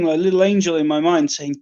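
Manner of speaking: usual speaking voice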